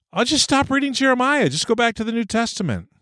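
high-pitched, light mock dismissal; breezy avoidance. Preacher confessing how he dodges hard scripture, breezily dismissive. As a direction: toss it off high, as an easy way out. Acts out his own easy avoidance.

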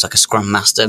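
usual speaking voice

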